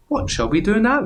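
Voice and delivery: high excited question; big skeleton asking. Acted. Big skeleton asking friends what next, high and excited. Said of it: "he said".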